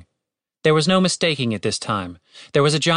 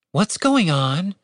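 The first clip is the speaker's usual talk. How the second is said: slow, slightly higher yawned question; yawning; 6 semitones higher, slower than usual. A narrator's reading. Catherine walks over bleary-eyed, yawning. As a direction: ask it through a light yawn. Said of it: "She yawned".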